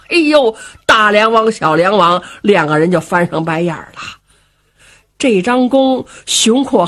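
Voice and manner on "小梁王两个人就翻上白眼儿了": ordinary delivery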